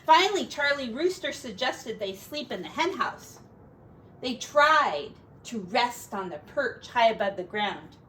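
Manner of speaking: gentle storybook narration; reading to children. Acted. Parent reading a picture book to a child, storybook voice. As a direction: read it aloud to a little one.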